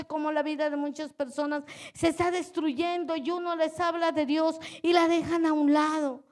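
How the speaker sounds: ordinary delivery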